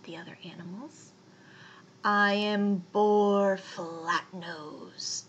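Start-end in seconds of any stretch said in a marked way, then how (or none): 2.0-5.2 slow, heavy boar voice; snorting boar. A narrator's reading. Boar demanding a place in the mitten, snorting. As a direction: snort it out slowly, building louder. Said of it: "he snorted".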